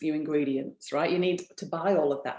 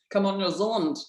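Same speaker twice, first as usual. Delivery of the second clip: put-on New Zealand accent; 3 semitones higher than usual. Speaker repeating her call to New Zealand viewers, lighter, in a New Zealand accent. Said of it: "That's my New Zealand accent".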